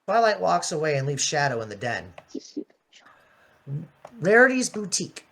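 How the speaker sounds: ordinary delivery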